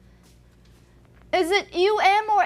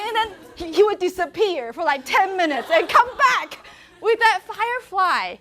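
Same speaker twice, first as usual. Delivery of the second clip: laughing; 5 semitones higher, 3 dB louder than usual. Real, not acted. Laughing while telling.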